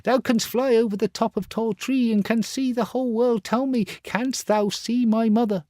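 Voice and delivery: put-on pleading boy. Star Child asking the mole about his mother, very high. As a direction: ask the mole urgently.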